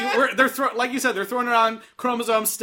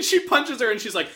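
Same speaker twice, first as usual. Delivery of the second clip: ordinary delivery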